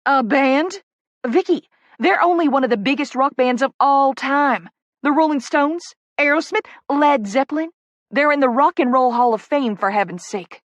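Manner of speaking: loud, high Southern disbelief; scandalized gasp. A narrator's reading. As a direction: protest in shocked, excited astonishment. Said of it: "Poppy gasps".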